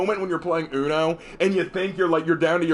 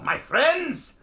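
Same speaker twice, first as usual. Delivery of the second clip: high, loud German orator, hammed up; slow mock-rousing speech with dramatic pauses; the Major; 6 semitones higher, 4 dB louder, much slower than usual. Streamer builds up a drama-class war speech, loud hammed-up German orator. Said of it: "I did the Major's I Love War speech".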